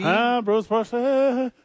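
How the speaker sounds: slow, high mock-French "Ah, Bruce Bochy" pronounced "Bochay"; mock French accent. Teasing a cohost, saying the name in mock French.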